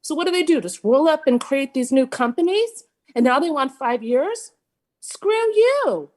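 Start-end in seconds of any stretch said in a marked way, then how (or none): 5.0-6.1 sudden high exasperated jab; frustrated outburst. Real, not acted. Homeowner at a public meeting, losing patience with developers: exasperated outburst. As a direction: snap it out, fed up with the builder. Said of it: "I'm frustrated".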